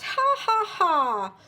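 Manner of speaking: high mocking ha ha ha; gloating laugh. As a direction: laugh in the farmer's face. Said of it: "The shopkeeper laughed".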